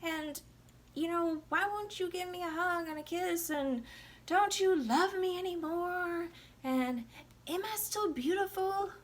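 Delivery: high, whiny needy voice; whiny wife. Retold or imitated. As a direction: whine like a clingy wife begging for attention. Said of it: "she's just like".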